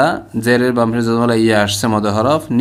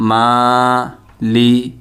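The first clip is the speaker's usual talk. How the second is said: Maliki split as maa, li, ki with pauses between; very slow, separated teaching demo; broken-up syllables; much slower than usual. Shown as a demonstration. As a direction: say Maliki one syllable at a time. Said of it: "ভাঙা ভাঙা উচ্চারণ করলে".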